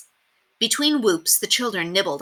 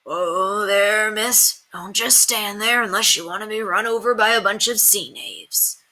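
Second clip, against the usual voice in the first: put-on old coachman voice; 3 semitones higher than usual. A narrator's reading. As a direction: redo it as old Timothy. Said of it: "that was not the right voice".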